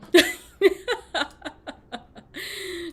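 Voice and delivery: high, loud laughing fit; long hearty laughter. Real, not acted. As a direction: laugh helplessly at your own joke. Cracks up at own accent.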